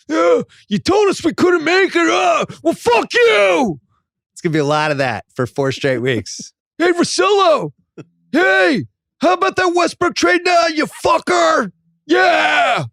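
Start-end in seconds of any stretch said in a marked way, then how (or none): 0.1-3.8 high, full-throated angry yell; out-of-control fan. Acted. Rockets fan raging at doubters, out-of-control high yell. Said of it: "they are gonna be out of control". 6.8-12.8 high, gloating full-throated yell; Rockets Twitter fan. Acted. Rockets fan gloating at Russillo about the trade, high obnoxious yell. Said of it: "welcome to Rockets Twitter".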